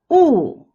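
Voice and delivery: "wu" loud, falling from high. Shown as a demonstration. Teacher leads repeat drill, falling wu. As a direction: drop "wu" from the top. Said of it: "The fourth tone is the falling tone".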